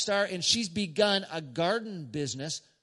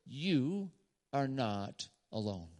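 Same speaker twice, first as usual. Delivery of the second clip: quiet, slow quote; 4 semitones lower, 6 dB quieter, slower than usual. Retold or imitated. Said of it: "and he used these words".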